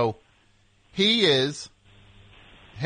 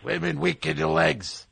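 put-on gruff old trainer voice; old coach scolding his boxer; Mickey voice; 3 semitones higher than usual.

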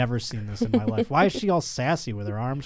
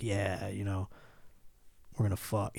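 lower, softer laid-back cool-guy murmur; smooth ladies' man; 5 semitones lower, 13 dB quieter than usual. Retold or imitated. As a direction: play it as the chill cat who always has girls. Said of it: "He's like".

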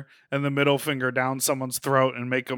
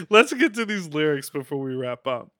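ordinary delivery

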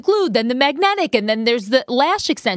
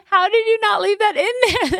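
high, amazed disbelief; 10 semitones higher than usual. Real, not acted. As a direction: ask it in delighted disbelief. Amazed laughing question.

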